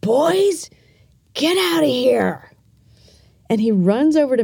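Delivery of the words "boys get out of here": higher, lightly voiced uncle sending the boys away; a hint of a stern uncle pointing to the door; uncle ordering boys out. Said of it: "He just points to the door and he goes".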